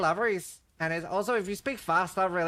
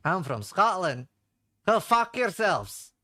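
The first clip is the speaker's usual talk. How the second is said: put-on slow, drawn-out Scottish accent; slower than usual. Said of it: "A Scottish accent".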